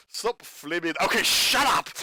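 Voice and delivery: reading that bursts into a loud, high yell; imitated fed-up outburst; snapping in frustration. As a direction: break off and explode on "shut up". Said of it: "He just snaps and just goes".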